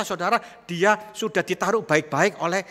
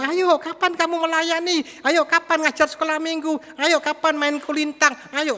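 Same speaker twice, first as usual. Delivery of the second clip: high, coaxing mock nag of a pastor; lightly voiced pushy church elder pestering; nagging pastor; 11 semitones higher than usual. Pastor pestering a church member to serve, high mock nagging.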